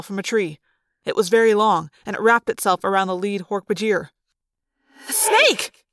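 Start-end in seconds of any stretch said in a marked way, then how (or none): 5.1-5.8 very high cry of alarm; startled yell. A narrator's reading. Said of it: "Rachel yelled".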